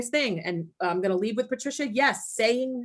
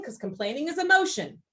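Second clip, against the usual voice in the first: normal talking voice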